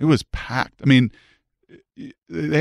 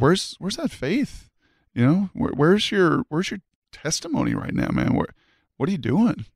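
higher, pressing questions in a lightly voiced coach; retold coach's challenge; 6 semitones higher than usual. Assistant coach grabbing the raging player, higher challenging retold voice. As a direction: replay the coach demanding where your faith went, pitched up. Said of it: "I was like".

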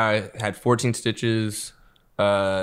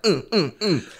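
mock slap grunts; 8 semitones higher, slower than usual. Imitating the slap sounds.

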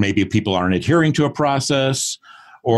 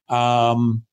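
ordinary talk, nothing marked

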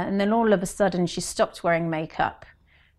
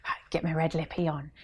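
whispered pep talk; 5 dB quieter than usual. Retold or imitated. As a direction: psych yourself up under your breath. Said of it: "I'd be like".

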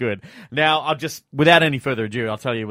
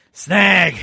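snag in a broad Aussie drawl; broad australian accent; 4 semitones higher, 7 dB louder than usual. Shown as a demonstration. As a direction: say snag like a true bogan. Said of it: "you sound pretty bogan".

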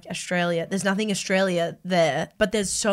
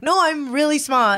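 higher, louder, slow defensive protest; mock insistence; 7 semitones higher, 6 dB louder than usual. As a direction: insist on being smart, half-joking. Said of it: "You're like".

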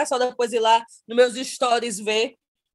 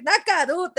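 ordinary delivery

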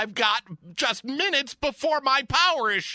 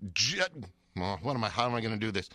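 much lower, quiet muttering aside; baffled muttering; 13 semitones lower, 7 dB quieter, much faster than usual. Acted. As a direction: mumble it to yourself, stumped.

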